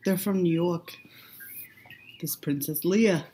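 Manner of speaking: bad New York accent. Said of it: "That was a terrible New York accent".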